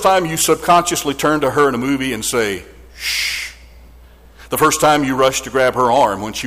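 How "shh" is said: a soft shushing whisper, as in a dark cinema; hushing whisper. Retold or imitated. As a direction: quietly hush her. Hushing mother in a movie.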